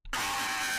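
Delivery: sharp high-pitched yell of joy; high shout. Real, not acted. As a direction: let out a piercing happy scream. Shout of joy after win.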